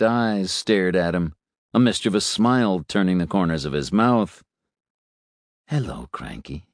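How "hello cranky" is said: a low, even robot priest voice. A narrator's reading. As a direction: greet the boy gently in a lower robot voice. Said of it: "Patchett said".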